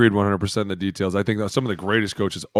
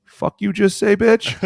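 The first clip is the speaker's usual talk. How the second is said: much higher, incredulous acted outburst; indignant mock challenge; 12 semitones higher, 5 dB louder, slower than usual. Teammates defending their O-line coach, indignant mock outrage. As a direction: throw it out as a high-pitched challenge. Acted reaction in retold quarrel.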